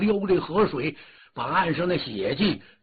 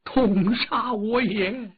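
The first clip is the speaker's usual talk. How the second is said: slow, drawn-out opera-style wail; anguished cry; 5 semitones higher, much slower than usual. Acted. The Dragon King learns his son is dead, anguished wail. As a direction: wail it out in grief. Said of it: "大叫了一声啊".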